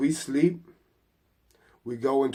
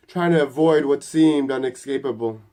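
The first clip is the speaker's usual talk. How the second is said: louder narration of rising panic; mild panic; 2 semitones higher, 7 dB louder than usual. A narrator's reading. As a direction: narrate it with urgency, voice raised. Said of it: "he starts panicking, yelling".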